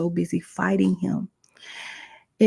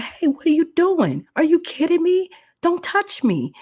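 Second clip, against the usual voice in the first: much higher, indignant objection; alarmed protest; 12 semitones higher, 3 dB louder than usual. Retold or imitated. Young woman fends off a man grabbing her, alarmed high protest. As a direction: push him away with your voice. Retold fending off an attacker.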